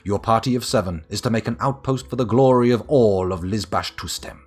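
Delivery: booming fantasy narrator; dramatic narration. Acted. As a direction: proclaim it like an epic saga opening. Reads the game's intro text.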